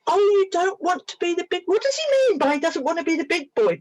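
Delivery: put-on high-pitched baby-talk; retold high, surprised cooing; cooing to tiny creature. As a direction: coo softheartedly, as to something very young. Cooing to the imagined young creature.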